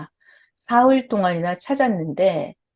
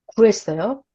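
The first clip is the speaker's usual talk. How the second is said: ordinary talk, nothing marked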